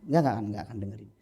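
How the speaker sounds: ordinary delivery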